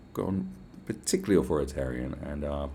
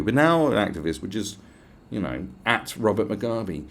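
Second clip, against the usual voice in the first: usual speaking voice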